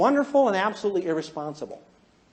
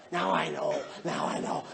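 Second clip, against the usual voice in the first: low, soft, sinking into a whisper; hushed scheming; 5 semitones lower, 8 dB quieter than usual. Acted. Red parent plotting after class, hushed scheming. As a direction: relish the secret power under your breath. Red relishing newfound power.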